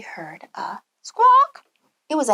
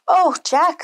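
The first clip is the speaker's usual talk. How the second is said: relieved; 7 semitones higher, 5 dB louder than usual. Acted. Mother relieved.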